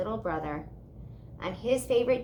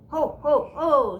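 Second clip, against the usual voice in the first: loud, higher jolly ho ho ho; Santa's laugh; 6 semitones higher, 8 dB louder, slower than usual. A narrator's reading. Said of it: "Santa laughed".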